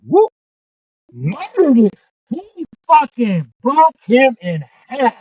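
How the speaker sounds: a hint of a hyped-up announcer; J R call. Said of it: "I can just hear it in J R's voice".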